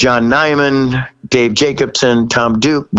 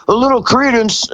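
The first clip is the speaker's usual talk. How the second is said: high deadpan reply; 10 semitones higher than usual. Retold or imitated. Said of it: "And he goes".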